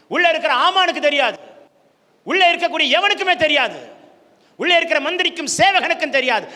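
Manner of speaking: loud, high, ringing sermon delivery; building, impassioned preacher's cry; full-force preaching. Real, not acted. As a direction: hammer each phrase out to the congregation.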